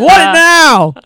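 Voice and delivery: high, loud exasperated shout; yelling across the room. Retold or imitated. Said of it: "Reminds me of, uh, Wedding Crashers".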